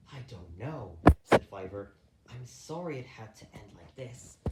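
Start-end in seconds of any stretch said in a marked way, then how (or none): 0.1-1.0 soft hopeless voice; despairing. Acted. Despairing Fiver, softer. 2.3-4.4 whispered sorrowful goodbye. Acted. Whispered farewell.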